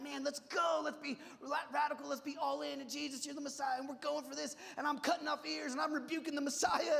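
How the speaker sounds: high, loud, pumped-up call to go all in; zealous rally cry. Retold or imitated. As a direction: fire up the crew like an eager fighter. Peter's zeal, impulsive charge.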